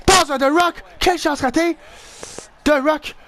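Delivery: high, urgent call-out; urgent excitement. Real, not acted. Player calling for a pass to The Rock, urgent. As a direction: cry out at the missed chance. Missed scoring chance.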